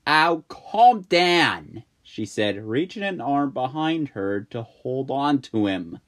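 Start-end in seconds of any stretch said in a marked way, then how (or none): 0.1-1.8 slow, loud brush-off; dismissive. Acted. May brushing off Jack's panic, slow. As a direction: wave off his fear.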